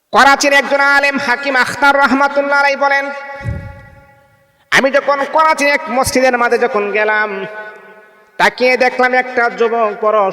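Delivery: high, ringing sermon chant; fervent waz preaching, pitched high and loud; impassioned preaching. Acted. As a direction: lift the voice and call out to the crowd. Preacher opens the scholar's story.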